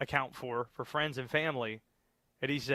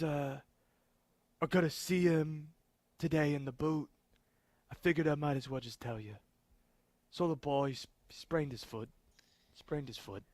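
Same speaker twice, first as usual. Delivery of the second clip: thick Jersey accent, hammed up; Dan Hurley Jersey accent. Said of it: "It's almost like you're overdoing the accent".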